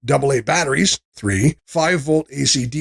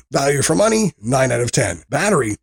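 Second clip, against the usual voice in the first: ordinary talk, nothing marked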